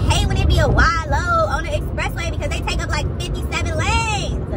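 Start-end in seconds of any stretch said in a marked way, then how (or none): none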